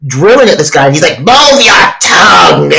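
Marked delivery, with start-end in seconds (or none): 1.2-2.7 loud, drawn-out mock shout; shouted order. Dentist to patient while drilling, drawn-out mock shout. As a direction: stretch the command out at the top of the voice.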